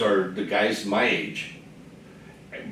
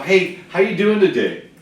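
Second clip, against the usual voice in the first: cheery greeting; 5 semitones higher, 4 dB louder than usual. Retold or imitated. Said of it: "you are".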